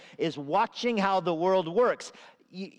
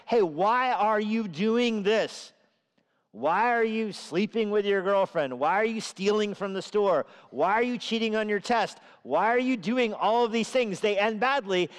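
wisdom calling out; 4 semitones higher than usual. Acted. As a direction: call out to the sinner like a stern teacher. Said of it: "they hear wisdom's voice".

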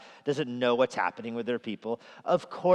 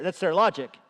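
ordinary delivery

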